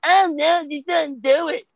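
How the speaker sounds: high-pitched mock fussing; mocking others. Mimicking people who object.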